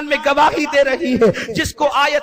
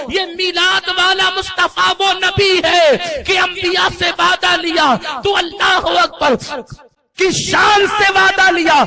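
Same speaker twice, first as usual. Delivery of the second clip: raised, ringing sermon delivery; जोशीला उपदेश; 4 semitones higher than usual. Acted. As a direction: preach it forcefully to a crowd. Preaches at full force. (जोश भरी तक़रीर)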